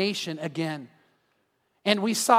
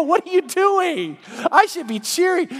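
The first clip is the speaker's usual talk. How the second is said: much higher, louder, laughing through it; laughing at himself; 10 semitones higher, 5 dB louder than usual. Retold or imitated. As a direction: poke fun at your own reaction. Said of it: "You'd go".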